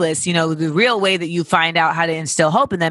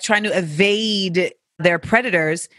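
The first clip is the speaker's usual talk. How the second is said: ordinary delivery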